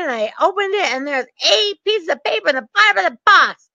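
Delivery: high-pitched, hammed-up outrage; mad bank rep. Bank rep fuming about the five-foot box, mock angry. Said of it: "she was so mad".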